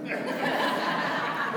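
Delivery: a quiet unvoiced laugh; soft breathy chuckle. Real, not acted. Laughing at his own stubborn "No" to the presbyter, soft chuckle. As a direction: let a breathy laugh slip out.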